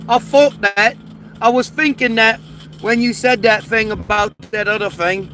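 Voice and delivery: put-on Cockney accent. American mimics how English people say things, mock Cockney. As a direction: imitate a Cockney saying F for TH. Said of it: "they say things".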